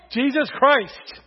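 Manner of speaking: with conviction. Shown as a demonstration. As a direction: draw it out loudly with conviction. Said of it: "say it with conviction".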